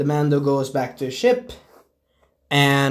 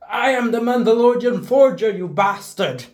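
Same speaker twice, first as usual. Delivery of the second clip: higher, louder mock battle cry; fierce warrior; 8 semitones higher, 3 dB louder than usual. Forger shouts her name at the stormtrooper she smashes, mock battle cry. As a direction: boom it out like a proud warrior, pitched up. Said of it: "She is like".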